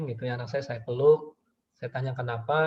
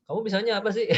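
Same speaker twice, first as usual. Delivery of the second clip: mock-exasperated question, pitched high; belittling parent; 7 semitones higher, faster than usual. Parent to child who cannot do it, mock-belittling. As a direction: imitate a belittling parent, voice jumping up. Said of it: "kalimat negatifnya".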